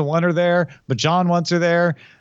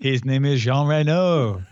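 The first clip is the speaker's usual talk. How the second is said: put-on Quebecois accent. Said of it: "does a horrible Quebecois accent".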